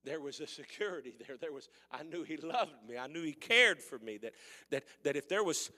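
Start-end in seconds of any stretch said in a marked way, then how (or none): 3.0-5.7 louder preacher's rise; preaching build. Acted. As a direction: build up again toward the shout.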